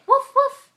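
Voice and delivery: sharp, high, loud puppy woofs; two short punchy high woofs; puppy barks. Acted. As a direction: bark it out like a little dog. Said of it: "What does the doggy say?".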